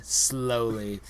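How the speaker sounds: usual speaking voice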